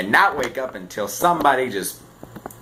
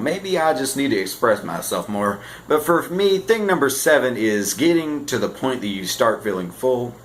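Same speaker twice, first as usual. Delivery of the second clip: usual speaking voice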